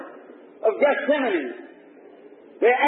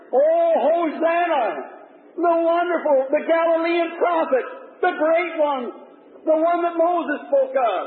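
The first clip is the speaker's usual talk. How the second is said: hollering praise; 7 semitones higher than usual. Acted. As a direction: hail him over the crowd noise. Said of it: "Some of them hollered".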